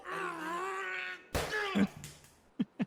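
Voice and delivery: high, loud crying plea; wailing apology. Acted. Trainee begging his coach's forgiveness, loud tearful wail. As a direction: cry out the apology in distress.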